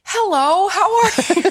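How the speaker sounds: high, bright sing-song hello to a newborn; baby greeting. Retold or imitated. Mom recalling greeting her newborn, high cooing baby talk. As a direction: coo a hello at the new baby, laughing.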